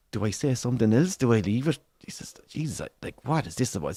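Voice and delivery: soft, near-whispered dithering; very quiet, uneasy aside; hushed inner voice. Retold or imitated. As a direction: murmur it to yourself, unsure. Man's private dithering beside angry stranger.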